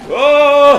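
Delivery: sudden loud, high "oh!"; startled yell. Real, not acted. Player startled mid-sentence by the game, startled yell. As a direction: yelp in real surprise, high and drawn out.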